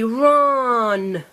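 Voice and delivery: "run" with sounds drawn out; said slowly. Shown as a demonstration. Teacher modelling a word for a child, said slowly. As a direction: stretch "run" slowly, sliding down. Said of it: "say the word slowly".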